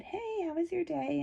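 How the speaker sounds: light, high, soft casual greeting; calm and breezy. Retold or imitated. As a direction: keep it easy and calm, hide the worry. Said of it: "I got out of the car very calmly".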